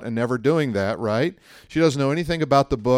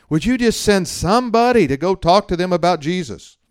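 pleading prayer; 8 semitones higher, 9 dB louder than usual. Pretend. Mock prayer for lost people.

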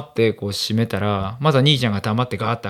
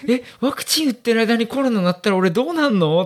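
mock panic; 11 semitones higher than usual. Panicked inner thought.

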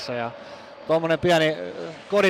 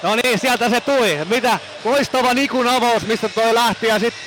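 excited; 6 semitones higher, 4 dB louder than usual. Real, not acted. Co-commentator reacting to goal.

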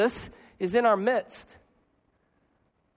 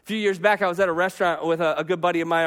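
ordinary talk

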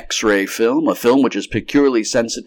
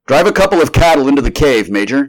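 brisk order in a raised voice; commanding, pitched up; directing; 7 semitones higher, 8 dB louder than usual. A narrator's reading. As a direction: give the officer a loud, high instruction. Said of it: "directed Doctor Bird".

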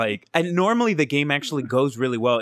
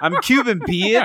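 laughing retort; 7 semitones higher, 4 dB louder, slower than usual. Real, not acted.

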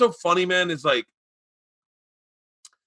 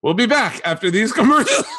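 higher-pitched show host cracking up; mock sign-off breaking into laughter; 9 semitones higher than usual. Comic retelling his friend's throw to commercials, cracking up. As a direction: mimic the host, losing it laughing.

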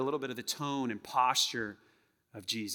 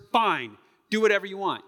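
frustrated spouse; 4 semitones higher, 7 dB louder than usual. Acted. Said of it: "We're communicating frustration".

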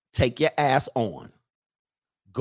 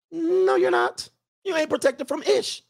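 "No, you're not" said much higher, insistent; 13 semitones higher than usual. Real, not acted. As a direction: push the No way up high. Repeated rebuttal, higher.

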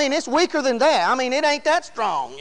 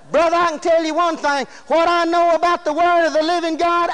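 impassioned, higher-pitched preaching; 4 semitones higher than usual. Real, not acted. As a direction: preach with full conviction, voice held up. Preaches at full force.

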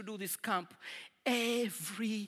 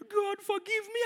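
mock cry; 10 semitones higher than usual. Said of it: "I also cried".

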